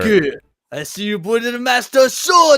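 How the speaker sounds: loud, slow hammy villain shout. Pretend. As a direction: boom it like an anime villain. Impression of Dio voice.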